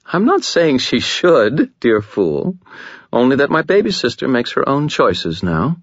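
a languid, amused vampire lady toying with the room; seductive Lara voice. Acted.

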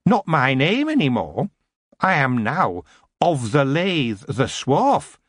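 proud goblin. A narrator's reading. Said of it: "said the goblin proudly".

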